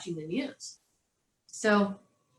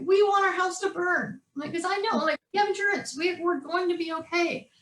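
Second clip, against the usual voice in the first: gleeful hype; 9 semitones higher than usual. Retold or imitated. Mother hyping kids about fire.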